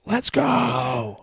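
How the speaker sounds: very high, drawn-out rallying yell; hyped-up battle cry. Acted. Player ready to follow Brady into battle, hyped-up cry. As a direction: whoop it out high, holding the last word.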